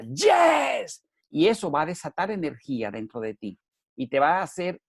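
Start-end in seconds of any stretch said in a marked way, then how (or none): 0.0-1.0 a much higher, loud shouted "yes". Shown as a demonstration. Speaker demonstrating the shout he asks listeners for. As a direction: shout it out as a war cry. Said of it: "ahora grita".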